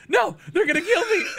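high, loud panicked cry; mock a panicked cry for help; hammed-up, high-pitched alarm; imitated panic. Imitating Chicken panicking in game.

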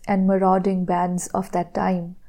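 ordinary delivery, nothing marked

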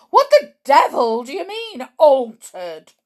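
loud, high, blustering demand; angry shout. A narrator's reading. Mr Jenkins challenges grandmother about his son, shouting. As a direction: bellow the question as an outraged father. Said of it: "shouted Mister Jenkins".

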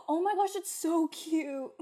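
gasping delight. Real, not acted. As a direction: gush over something adorable. Gasping over cute plushie.

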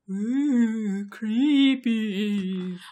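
drawn-out, mock-spooky "ooh". Podcaster teasing the creepy house, mock-spooky. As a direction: stretch it out like a ghost story.

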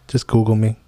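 ordinary talk, nothing marked

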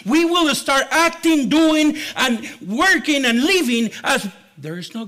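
loud, high-pitched preaching delivery; emphatic preaching. Acted. Preacher warning the church never to forget the word, emphatic. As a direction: preach it forcefully to the whole church.

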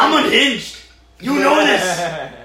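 loud, slow, hammed-up menace; mock villain. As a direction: play a madman villain for laughs. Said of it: "we're taking this bit too far".

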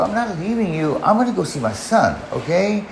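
high-pitched retold protest; exasperated insistence. Retold argument with crying partner.

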